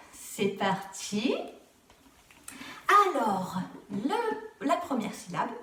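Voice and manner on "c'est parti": "c'est parti" stretched slow with a rising end; slow showy kickoff for kids; drawn-out announcement. Acted. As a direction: draw out "c'est parti" slowly to build suspense. Launching the rebus game.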